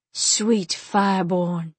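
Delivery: slow, drawn-out hiss. A narrator's reading. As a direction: hiss it slowly through the words. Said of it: "Ram hissed".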